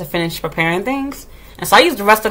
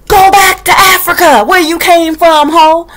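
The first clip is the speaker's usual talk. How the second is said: mock yell; 8 semitones higher, 7 dB louder, slower than usual. Mock telling off coworker.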